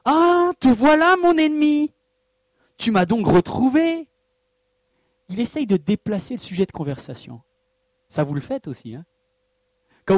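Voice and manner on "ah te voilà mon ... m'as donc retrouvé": cry out. Acted. Akab cries out on seeing Élie, loud and high. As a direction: spit it at your enemy. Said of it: "Akab s'écria".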